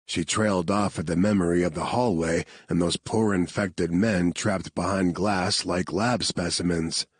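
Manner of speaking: plain reading voice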